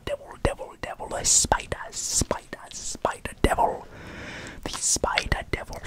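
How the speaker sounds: ordinary talk, nothing marked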